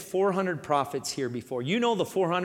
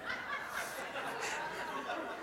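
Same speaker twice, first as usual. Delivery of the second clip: a real silent laugh of delight; breathy laugh; 15 dB quieter than usual. Real, not acted. Preacher amused by Ahab's words, silent laugh. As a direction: laugh under the breath at the line.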